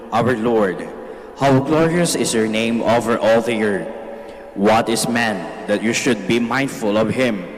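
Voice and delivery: usual speaking voice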